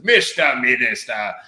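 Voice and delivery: loud, drawn-out British parliamentary cry; mock Eton English. Debater addressing the prime minister, hammed-up Eton English. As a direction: declaim it slowly in a hammed-up English accent. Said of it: "arguing a point in front of the prime minister's".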